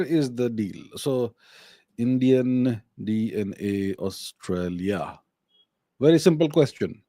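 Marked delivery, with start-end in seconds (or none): 2.0-5.2 dictating a search. Real, not acted. Typing a search query aloud.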